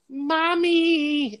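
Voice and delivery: put-on very high, small child calling for mommy; kid's voice. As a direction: whimper it like a frightened little boy. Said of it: "in, like, a kid's voice".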